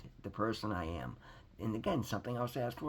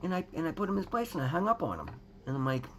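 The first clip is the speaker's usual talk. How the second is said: ordinary delivery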